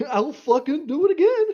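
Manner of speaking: high, loud cartoonish threat through a laugh; imitating a silly voice. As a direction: copy the squeaky voice, laughing.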